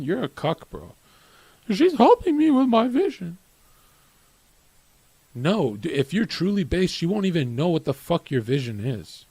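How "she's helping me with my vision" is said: very high mimicking voice; mocking whine. Streamer repeats a smitten man's excuse to shame him, mocking. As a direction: push the pitch way up to ridicule the quote. Mocks men defending their girlfriends.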